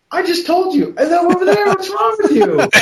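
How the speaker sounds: put-on high, loud, fed-up childish outburst; exasperated kid. Little boy snapping at the forgetful old farmer, exasperated and loud. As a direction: blurt it out, fed up and laughing.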